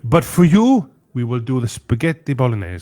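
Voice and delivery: a proud Italian owner making an offer; Italian restaurant owner conceding grandly; Italian owner accent. Retold or imitated. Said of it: "she got really angry".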